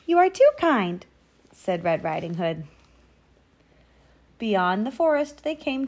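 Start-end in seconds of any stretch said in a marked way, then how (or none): 0.1-1.1 put-on high, louder girlish thanks; grateful little girl. A narrator's reading. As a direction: thank him like a trusting child.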